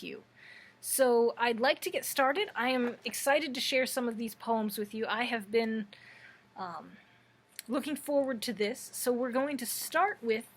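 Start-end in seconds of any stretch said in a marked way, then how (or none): none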